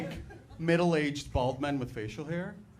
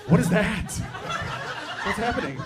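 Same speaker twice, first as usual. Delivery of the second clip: put-on shocked kid; 6 semitones higher, 6 dB louder than usual. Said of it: "I was like".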